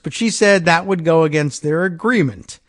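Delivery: ordinary delivery, nothing marked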